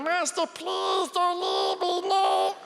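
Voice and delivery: high, slow desperate Gollum whine; high pleading creature wail. Retold or imitated. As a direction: beg the master not to leave.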